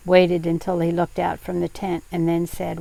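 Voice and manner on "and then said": plain reading voice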